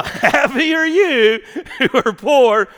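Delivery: high, loud, drawn-out verse with a laugh; exaggerated upbeat repeat. Real, not acted. Preacher repeats puzzling verse to baffled congregation, exaggeratedly upbeat. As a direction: belt it out slowly, high and amused.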